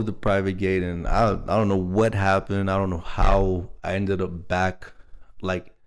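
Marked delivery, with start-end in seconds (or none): none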